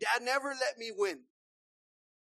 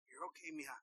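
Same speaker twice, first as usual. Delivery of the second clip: soft comforting dad; 8 semitones lower, 18 dB quieter, faster than usual. Retold or imitated. Daddy comforting a child.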